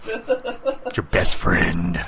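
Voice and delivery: very slow, stretched-out words; drawn-out joke. Pretend. Friend finishing his bath-duck joke, drawn out. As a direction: draw every word right out with a laugh.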